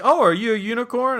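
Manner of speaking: high, loud, exaggerated interest; gushing to kids. Adult greeting a child in costume, high enthusiastic question. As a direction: coo over the costume brightly.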